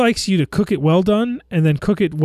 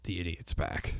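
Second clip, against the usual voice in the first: low, quiet villain quote; 9 semitones lower, 14 dB quieter than usual. Retold or imitated. Echoing the villain.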